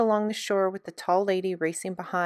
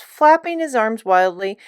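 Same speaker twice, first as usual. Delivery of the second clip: ordinary delivery, nothing marked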